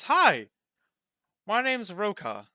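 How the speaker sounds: high, loud eager greeting from a small creature. Acted. Roka greets the newcomer in a high eager voice. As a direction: chirp your name out, thrilled to meet them.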